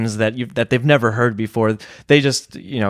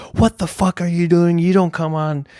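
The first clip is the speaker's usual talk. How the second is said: raised-pitch mock yell at a heckler; retold angry yell; 6 semitones higher than usual. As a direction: replay the fury, pitched up high. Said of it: "I started yelling at him".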